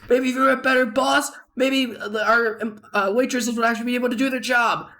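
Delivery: high, indignant mock yell; retold screaming match. Head chef firing back at his boss, high mock yelling. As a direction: imitate a chef hurling blame back. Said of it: "a screaming match".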